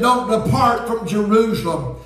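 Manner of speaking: ordinary talk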